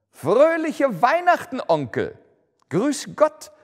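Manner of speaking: bright high greeting, called loud; cheery nephew calling out. A narrator's reading. Nephew bursting into the office, calling out cheerfully. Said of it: "rief eine muntere Stimme".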